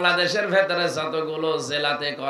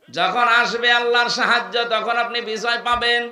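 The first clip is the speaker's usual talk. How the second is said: much higher and louder, the correct যখন আসবে আল্লাহর সাহায্য; right meaning proclaimed; 8 semitones higher, 7 dB louder than usual. Shown as a demonstration. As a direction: ring out the true meaning like a preacher's cry. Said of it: "তাহলে বাংলা অর্থ হবে".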